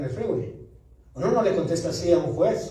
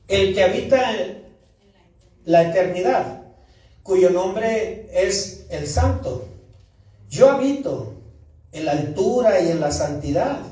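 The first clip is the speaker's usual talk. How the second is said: loud, slow, solemn Bible reading; scripture reading; 3 dB louder than usual. Acted. Preacher reading Isaiah to the congregation, solemn and loud. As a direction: proclaim each phrase with pauses.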